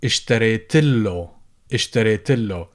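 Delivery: اشتريت له with the alif dropped; shortened form. Shown as a demonstration. Teacher demos the dropped alif for students. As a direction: run straight into له, no alif. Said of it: "people would say, jump over the alif".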